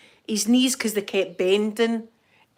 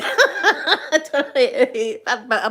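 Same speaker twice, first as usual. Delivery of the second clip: laughing through words; 4 semitones higher, 5 dB louder, much slower than usual. Real, not acted. Laughing at her own joke.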